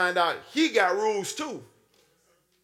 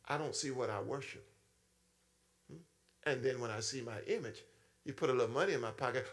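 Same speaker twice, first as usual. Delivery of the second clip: ordinary delivery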